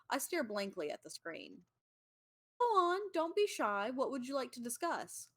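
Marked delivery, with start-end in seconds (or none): 2.6-5.3 higher, coaxing; encouraging God. Acted. God coaxing a shy Jake to talk, higher and encouraging. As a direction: nudge him gently to speak.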